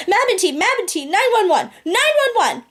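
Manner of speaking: high, urgent alarm calls; panicked calling out. Acted. Waking her nanny in a high-pitched panic. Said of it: "so obviously I panicked".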